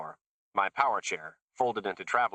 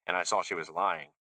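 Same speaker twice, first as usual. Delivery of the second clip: ordinary delivery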